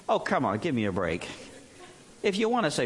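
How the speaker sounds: ordinary talk, nothing marked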